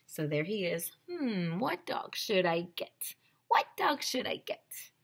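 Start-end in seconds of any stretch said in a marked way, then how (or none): 1.1-4.9 whispered musing to oneself; pondering whisper. Acted. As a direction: whisper it as if weighing a choice. Voices man pondering his choice.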